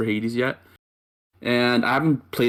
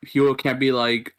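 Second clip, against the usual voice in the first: ordinary delivery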